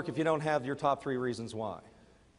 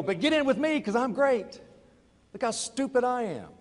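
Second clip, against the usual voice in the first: mock sales pitch; 9 semitones higher than usual. Mock pitch after admitting failure.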